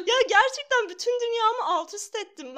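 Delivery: high, loud swooning gush; gushing excitedly. Pretend. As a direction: gush like you've fallen in love. Gushing love declaration to bird.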